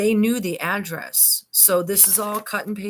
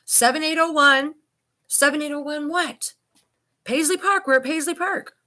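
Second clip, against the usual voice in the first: voicing the dispatcher; 10 semitones higher, 5 dB louder than usual. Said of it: "Emily says".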